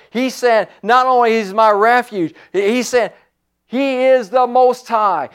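high, forceful preaching; fired-up preaching. Real, not acted. Preacher urging the saints to get radical, fired-up preaching.

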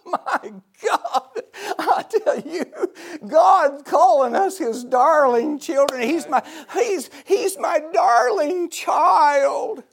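high, loud, tearful shout; overcome with emotion. Real, not acted. Preacher overwhelmed that God calls us darling children, tearful shout. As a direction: cry it out, overwhelmed by God's love.

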